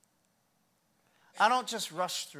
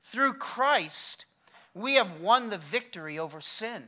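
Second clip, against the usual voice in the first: normal talking voice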